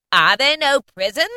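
high, loud ghostly taunt; mocking ghost. A narrator's reading. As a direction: throw his words back like a spirit. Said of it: "said the spirit".

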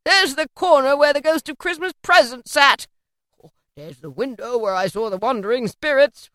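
whooping, delighted recognition; excited old Scrooge; giddy Scrooge. A narrator's reading. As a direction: exclaim each discovery with rising glee. Story figure Scrooge, giddy.

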